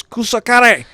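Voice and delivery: high, loud mock curse; angry golfer outburst. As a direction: imitate a golfer cursing after a bad shot. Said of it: "threw his club and he goes".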